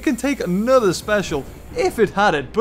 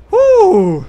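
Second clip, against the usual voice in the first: loud high woo; excited whoop; 8 semitones higher, 8 dB louder than usual. Real, not acted. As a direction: let out a big cheer. Excited cheer.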